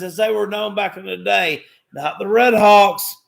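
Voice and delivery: ordinary delivery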